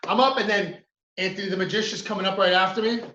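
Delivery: ordinary talk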